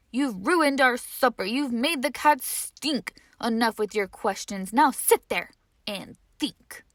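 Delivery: loud, exasperated telling-off; scolding parents. Acted. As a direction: lay down the law.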